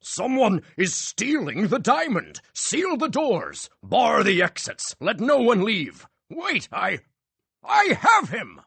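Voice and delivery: high, loud, slow shouted commands; rally everyone in a panic; alarmed orders. A narrator's reading. Bosso shouting orders in dark.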